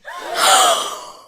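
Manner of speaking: loud, high intake of breath; astonished gasp. Acted. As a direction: gasp sharply at the revelation. Gasp at the empty tomb.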